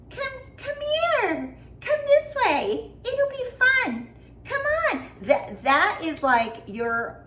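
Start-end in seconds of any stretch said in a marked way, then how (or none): 0.1-5.1 put-on very high, slow, luring child voice; creepy little boy. Mimicking the boy from her short, very high creepy lure. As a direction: coax them in like a creepy kid from a horror short. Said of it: "think of that little boy, go".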